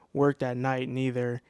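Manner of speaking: normal talking voice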